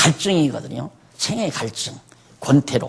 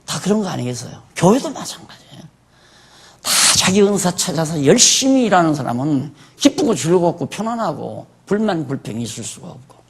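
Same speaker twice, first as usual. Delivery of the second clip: normal talking voice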